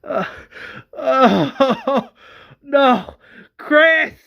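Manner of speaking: high, loud acted sobbing; hammed-up anguished crying; crying out in grief. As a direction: wail for your dead child. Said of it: "Tyrese also cries".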